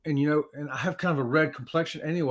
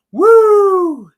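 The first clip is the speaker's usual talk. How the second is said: excited whoop; 14 semitones higher, 13 dB louder than usual. Real, not acted. Host cheering on livestream list.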